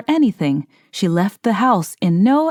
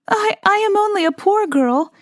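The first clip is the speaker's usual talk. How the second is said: put-on much higher, hesitant young girl; lightly voiced shy girl, higher; timid girl; 11 semitones higher than usual. A narrator's reading. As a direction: stammer it shyly, high. Timid girl answers king.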